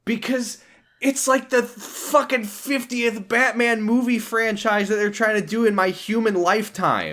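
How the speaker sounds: real exasperation about endless reboots; exasperated rant. Real, not acted. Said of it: "Why are you so mad?".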